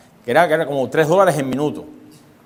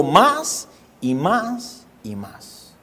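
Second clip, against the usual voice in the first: slow, dragged-out more and more; drawn-out more; 5 dB quieter, much slower than usual. Acted. Preacher describing the flesh's endless craving, slow and drawn. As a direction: stretch each más like endless craving.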